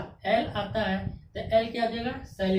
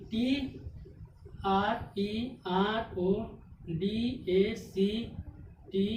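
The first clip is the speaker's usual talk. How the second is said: slow letter spelling; much slower than usual. Shown as a demonstration. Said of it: "teacher spells word letter by letter".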